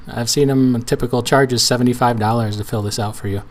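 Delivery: ordinary talk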